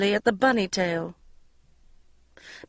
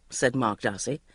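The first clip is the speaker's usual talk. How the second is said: ordinary delivery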